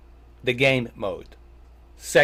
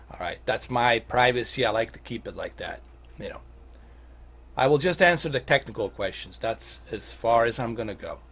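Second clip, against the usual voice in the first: ordinary delivery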